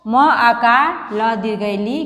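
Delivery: slow, syllable by syllable spelling of ली; teacher spelling a word aloud for the class. Shown as a demonstration. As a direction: spell out each letter slowly like a teacher. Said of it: "इसको हम कैसे पढ़ेंगे".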